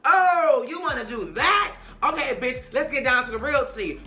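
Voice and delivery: high, loud challenging comeback; mock confrontation. Cynthia firing back at Nene in a quarrel, loud challenge. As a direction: come back loud and high, ready to argue.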